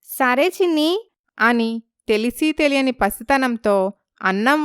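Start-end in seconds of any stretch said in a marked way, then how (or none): 0.1-1.1 put-on high small-child voice; child's high reply; little child agreeing. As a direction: answer like a young sibling.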